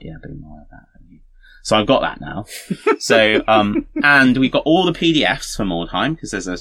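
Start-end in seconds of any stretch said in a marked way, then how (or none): 0.0-0.9 hushed. Retold or imitated. Quiet triumphant retold quote.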